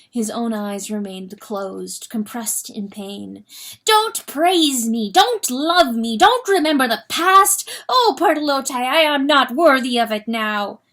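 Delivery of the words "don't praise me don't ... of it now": anguished pleading. Acted. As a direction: beg her to stop, voice breaking out.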